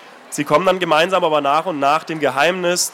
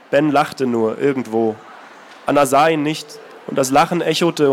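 calm, measured reading of a novel; story narration; 3 semitones lower than usual. A narrator's reading. Author reading his novel aloud, steady narration. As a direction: read the passage steadily, letting it speak.

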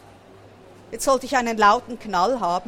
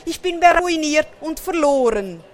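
high, loud, despairing lament; hammed-up storybook misery; wailing; 6 semitones higher, 5 dB louder than usual. A narrator's reading. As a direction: lament as if all is lost. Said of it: "jammert er".

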